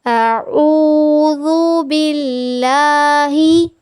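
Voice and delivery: slow, melodic tajweed recitation; Quran recitation. Shown as a demonstration. Teacher recites for her students with slow melodic tajweed.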